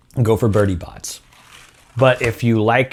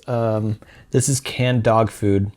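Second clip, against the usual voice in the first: ordinary talk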